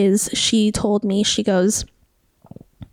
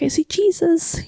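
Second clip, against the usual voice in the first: higher voice, mildly imitating tears; retold tearful cry; 7 semitones higher than usual. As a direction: relive the tearful moment, pitched up. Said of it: "I'm crying, I'm crying".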